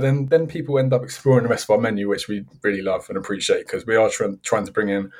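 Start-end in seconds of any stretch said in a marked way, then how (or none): none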